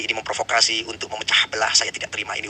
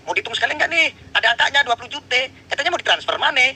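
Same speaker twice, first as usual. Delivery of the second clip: high, sneering challenge; mocking taunt; 12 semitones higher, 3 dB louder than usual. Real, not acted. Host mocking the guest's empty promise of money. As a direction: needle him about the missing money.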